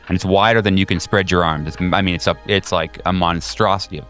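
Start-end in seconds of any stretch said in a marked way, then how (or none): none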